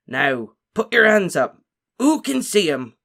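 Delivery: loud, slow, booming teacher call; rally the class like a jolly giant; hearty Hagrid. A narrator's reading. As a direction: Hagrid asking class to raise hands, loud.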